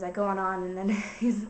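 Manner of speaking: ordinary delivery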